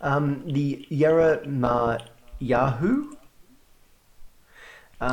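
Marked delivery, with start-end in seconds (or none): 0.5-3.1 Yarra Ma Yahoo very slowly, in separate pieces; careful, unsure pronunciation. Real, not acted. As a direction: sound out "Yarra Ma Yahoo" haltingly, pausing before Yahoo. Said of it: "I'm not trying to do this badly on purpose".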